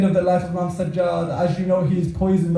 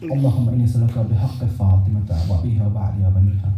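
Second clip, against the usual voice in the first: low-pitched, subdued prayer recitation; closing supplication prayer; 9 semitones lower, faster than usual. Real, not acted. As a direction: lead the mourners in a low, solemn plea to God.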